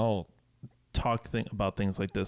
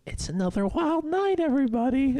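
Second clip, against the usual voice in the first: breezy cool mom. Retold or imitated. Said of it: "they just like".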